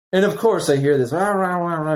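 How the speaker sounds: high, loud "rah, rah" yelling noise; mock yelling. Range officer yelling behind me, mock yelling. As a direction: imitate an old man yelling nonsense from afar. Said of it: "yelling behind me".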